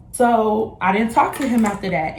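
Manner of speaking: ordinary talk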